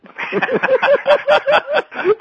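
high, loud burst of laughter; hearty laugh. Real, not acted. Host cracks up at the final line, loud laughing. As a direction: crack up at your own impression.

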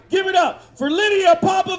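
big rising shout to bring the act on; hype emcee introduction. Real, not acted. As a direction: stretch out the name like a ring announcer. Host introduces a performer.

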